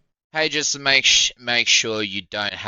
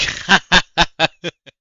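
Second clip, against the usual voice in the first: incredulous laugh; 9 semitones higher, 11 dB louder than usual. Real, not acted. Laughing at message.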